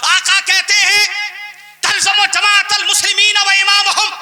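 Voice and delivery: high, ringing pulpit declamation; fiery hadith recital. Real, not acted. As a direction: proclaim the sacred quote to the congregation. Preaching, quoting hadith.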